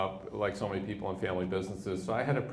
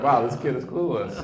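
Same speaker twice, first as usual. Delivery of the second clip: amused scoff through laughter; laughing mock quote; 3 semitones higher, 8 dB louder than usual. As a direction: laugh it off like the banker. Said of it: "Jerry laughed".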